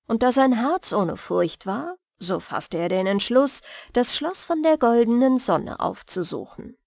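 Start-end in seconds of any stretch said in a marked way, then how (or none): none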